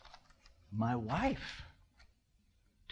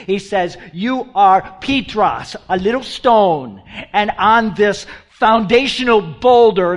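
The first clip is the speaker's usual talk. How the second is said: full-force preaching; 6 semitones higher, 17 dB louder than usual. Acted. Preacher proclaiming Jesus's promise to the congregation, booming. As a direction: thunder the promise at the church.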